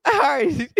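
real laughter through the words, pitched high; laughing. Real, not acted. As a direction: regain composure while still laughing. Host laughing as segment wraps up.

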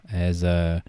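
ordinary delivery

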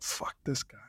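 muttered unvoiced aside; hushed sneering aside; mock DJ grumble. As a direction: mutter it under your breath with scorn. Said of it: "and they're like".

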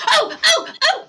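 eager high-pitched "oh, oh, oh"; Remy. Acted. Remy raising her hand to answer, eagerly. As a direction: squeal to be picked. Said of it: "And Remy goes".

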